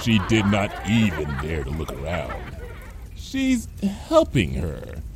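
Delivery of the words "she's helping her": hesitant, falling in pitch; halting, careful answer; saying it slowly. A narrator's reading. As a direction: pick each word with care. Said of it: "Cheerilee said slowly".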